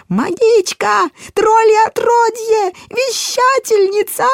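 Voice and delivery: high, slow, shrill shriek. A narrator's reading. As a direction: shriek it in hysterical horror. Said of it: "раздался визг".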